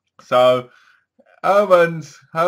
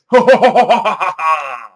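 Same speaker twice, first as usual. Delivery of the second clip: louder, higher ghostly cackle; evil cackle; 5 semitones higher, 10 dB louder than usual. Retold or imitated. Reviewer imitating the laugh from the painting, louder and higher. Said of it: "from the painting".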